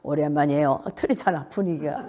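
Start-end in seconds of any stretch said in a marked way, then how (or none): 0.0-0.8 sunken, low-pitched greeting; low, level hello without energy. Shown as a demonstration. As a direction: let the greeting sink down low. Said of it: "낮은 자는 이제 목소리가 이렇게 착 가라앉은".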